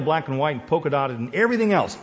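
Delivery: ordinary delivery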